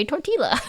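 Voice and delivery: "tortilla" with the L pronounced; Canadian pronunciation. Shown as a demonstration. Streamer in Canadian grocery store, "tortilla" with an L sound. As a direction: say "tortilla" as tor-till-a, not with a Y. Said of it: "in my Canadian grocery store".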